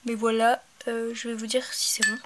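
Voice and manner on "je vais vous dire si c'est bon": ordinary delivery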